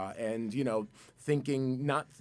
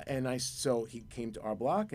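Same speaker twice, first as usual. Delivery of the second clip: ordinary talk, nothing marked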